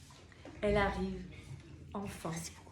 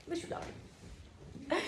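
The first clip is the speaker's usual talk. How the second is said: breathy laughing aside, higher; laughing whisper; 8 semitones higher, 7 dB quieter, slower than usual. Real, not acted. Speaker joking she is queen, laughing. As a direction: giggle the joke out. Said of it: "jokes she is the queen".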